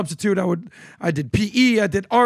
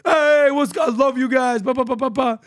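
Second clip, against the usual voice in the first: higher, louder loud-guy greeting; New Jersey guy; 5 semitones higher, 3 dB louder than usual. Retold or imitated. Retired cop greeting the hosts at UFC, hyped Jersey voice. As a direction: greet them like a pumped-up Jersey stranger. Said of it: "This guy from New Jersey shows up".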